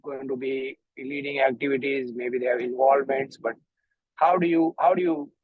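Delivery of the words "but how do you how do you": ordinary talk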